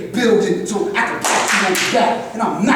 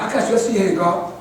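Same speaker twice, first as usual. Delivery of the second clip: ordinary talk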